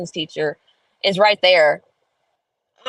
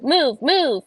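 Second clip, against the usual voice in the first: higher, bossy repeated order; mock yelling teacher; 9 semitones higher than usual. Son imitating the yelling teacher, mock bossy orders. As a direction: bark it like a mean teacher, lightly.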